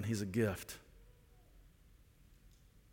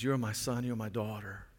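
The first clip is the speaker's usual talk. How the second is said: soft, lower, tender declaration; tender fatherly voice; 3 semitones lower, 4 dB quieter than usual. Retold or imitated. God the Father welcoming his children, soft and tender. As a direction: say it gently like a loving father. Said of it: "And he says".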